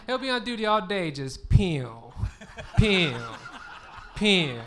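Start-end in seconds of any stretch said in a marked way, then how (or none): 1.1-4.6 loud mock gunshot sounds; finger-gun pew. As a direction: fire off each pew like a kid playing cops. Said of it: "shoots people with his fingers".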